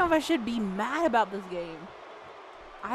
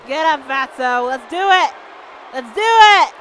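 rally yourself, building louder; fired-up challenge, growing louder; hyped-up calling out; 10 dB louder than usual. Real, not acted. Hyping up at game opponent.

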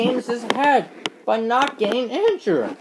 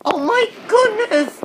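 shocked exclaim; 6 semitones higher than usual. Acted. Nurse shocked at the engine's injuries, crying out in alarm. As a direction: gasp it out in alarm.

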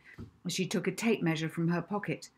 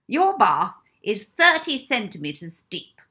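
slow, loud stern pronouncement; announced; 5 semitones higher, 10 dB louder than usual. Acted. Matron announcing the bath depth to Molly. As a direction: pronounce it like a verdict. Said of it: "Miss Addison announced".

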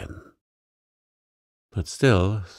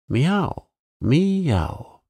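high-pitched cat mewing, rising then falling; high cat meows; 8 semitones higher than usual. A narrator's reading. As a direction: mew like an impatient cat at the door. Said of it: "interrupted Simpkin".

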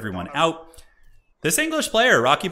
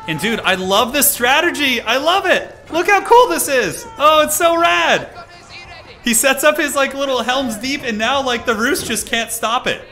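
higher and louder, voice cracking with thrill; excited; 11 semitones higher, 7 dB louder than usual. Real, not acted. Caster thrilled by the English palisade push, excited near-shouting. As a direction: gush over the strategy at the top of your voice. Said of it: "I got so excited my voice cracked".

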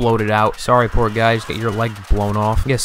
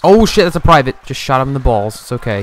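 ordinary talk, nothing marked

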